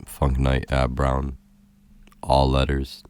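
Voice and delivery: slow, emphatic announcing of a handle; slow dictation. Real, not acted. Telling podcast listeners where to follow, slow emphatic dictation. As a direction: spell it out slowly for listeners to note down.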